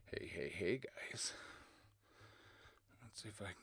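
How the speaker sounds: soft whispered hello; whispering. Real, not acted. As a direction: whisper the greeting. Whispered greeting opening the stream.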